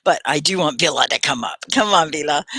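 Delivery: normal talking voice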